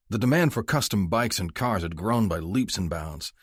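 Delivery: plain reading voice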